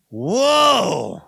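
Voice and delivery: loud drawn-out whoa; surprised whoa. Real, not acted. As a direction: react in disbelief. Reacts to two-hour sermons.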